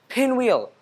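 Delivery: high called-out name; shouted. A narrator's reading. Owl shouting at Pinwheel by the tree, high call. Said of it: "shouted Owl".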